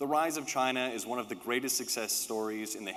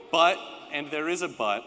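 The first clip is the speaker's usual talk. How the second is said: louder and higher, insisting on the but; raised voice flagging a catch; raised emphatic aside; 6 semitones higher, 8 dB louder than usual. Real, not acted. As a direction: call out the catch.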